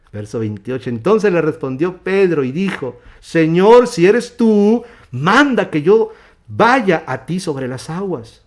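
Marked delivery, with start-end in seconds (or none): 3.2-8.4 loud, high, drawn-out plea; Peter's bold dramatized shout; calling out. Acted. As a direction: call across the water, voice raised. Acting Peter calling to Jesus.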